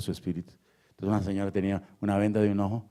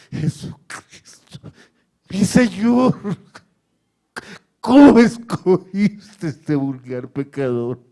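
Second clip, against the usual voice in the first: loud, high, drawn-out pleading; anguished prayer; 12 semitones higher, 14 dB louder, much slower than usual. Acted. Preacher cries to Christ about the cross, in loud anguished prayer. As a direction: cry out to God, falling away between bursts.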